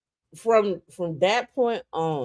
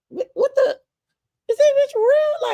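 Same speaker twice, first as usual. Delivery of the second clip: inner voice, disbelief. Retold or imitated. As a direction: shriek it like you can't believe your ears. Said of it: "I'm like".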